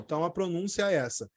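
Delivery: ordinary talk, nothing marked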